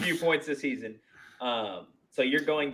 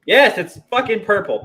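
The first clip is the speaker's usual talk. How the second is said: loud, higher exasperated exclaim; exasperated outburst; 10 semitones higher, 9 dB louder than usual. Real, not acted. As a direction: blurt it out, stung. Hurt foot, exclaiming.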